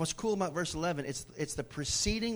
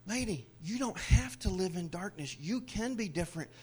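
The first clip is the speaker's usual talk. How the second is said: lightly voiced tender Jesus; 5 semitones higher, 4 dB louder than usual. Jesus comforting the woman, gentle then rising. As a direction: soothe her, then send her off. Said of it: "He was saying".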